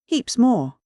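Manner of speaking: placid American drawl, starting high; unruffled Yankee sea captain accent; placid Yankee skipper. A narrator's reading. Skipper contradicting the professor, placid Yankee accent. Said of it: "remarked the man of the sea placidly".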